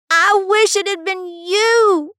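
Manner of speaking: high, loud, drawn-out scream. A narrator's reading. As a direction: hurl the words at your father in a scream. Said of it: "he screamed".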